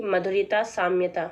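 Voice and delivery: ordinary delivery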